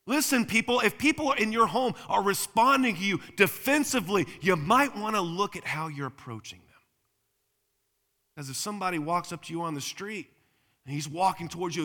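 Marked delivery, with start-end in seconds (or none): 0.1-6.6 high, loud pulpit admonition; raised, insistent sermon voice; forceful preaching. Real, not acted. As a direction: press the warning on the congregation.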